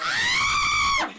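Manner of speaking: very high, long delighted squeal; excited squeal. Real, not acted. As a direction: squeal with glee at the news. Thrilled by announced witch episode.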